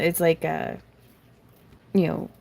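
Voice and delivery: ordinary delivery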